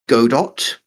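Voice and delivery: Godot as GO-dot, with a hard final T; go-dot pronunciation. Shown as a demonstration. Listing valid ways to say it, Godot as GO-dot. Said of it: "however you wanna pronounce it".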